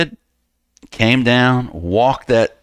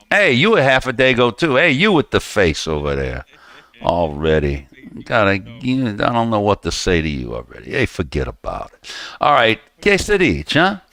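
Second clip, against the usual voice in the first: put-on Italian-American accent; 3 semitones higher than usual. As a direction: play a New York Italian-American guy. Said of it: "I do Dagos real good".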